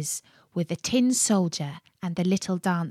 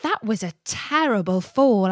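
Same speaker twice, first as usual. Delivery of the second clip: ordinary delivery, nothing marked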